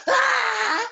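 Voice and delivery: short, loud "Ah!" yell; demonstrated yell. Shown as a demonstration.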